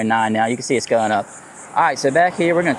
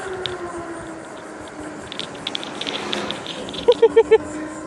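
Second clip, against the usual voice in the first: relieved laugh; 4 dB quieter than usual. Real, not acted. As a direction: burst of high nervous laughter. Near miss, "we almost died.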